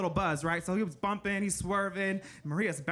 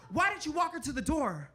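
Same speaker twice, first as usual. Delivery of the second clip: mad dad; 5 semitones higher than usual. Retold or imitated. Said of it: "He said".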